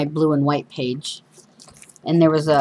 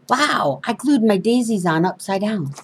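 surprised; 4 semitones higher than usual. Real, not acted. Notices daisies glued upside down.

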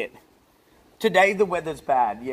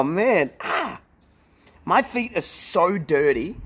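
ordinary talk

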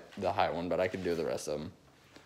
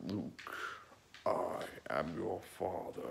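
slow, soft, masked villain voice; soft, slow Darth Vader breathing through a mask; 3 semitones lower, 11 dB quieter, slower than usual. Retold or imitated. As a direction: lean into the mask, slow and menacing. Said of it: "a little bit too low for him".

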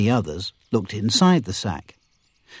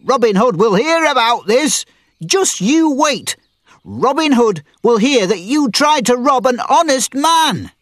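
high, loud furious threat; angry shouting; 12 semitones higher, 4 dB louder than usual. A narrator's reading. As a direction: threaten them at full voice. Miller yelling while hitting men.

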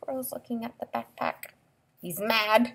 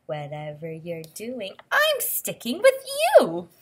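lilting storybook read-aloud; expressive picture-book voice; reading to children; 10 dB louder than usual. Acted. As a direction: read the book's lines to a little listener.

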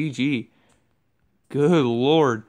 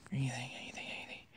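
breathless whisper; 19 dB quieter, much faster than usual. Real, not acted. Gamer pleads to the chest for loot, whispering. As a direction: whisper it hopefully under your breath.

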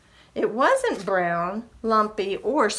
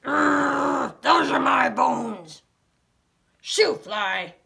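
loud growly dog voice; gruff barking dog; dog growling; 5 semitones higher, 4 dB louder, slower than usual. Acted. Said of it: "A dog growled".

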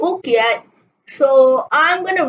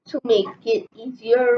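ordinary delivery

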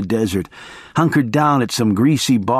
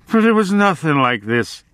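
ordinary delivery, nothing marked

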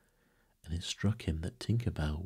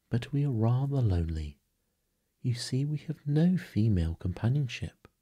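put-on higher, lightly wheedling boy; cunning; 6 semitones higher, 3 dB louder than usual. A narrator's reading. Peter angles for Wendy's company, mildly cunning. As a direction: plead loneliness with a touch of slyness. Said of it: "said cunning Peter".

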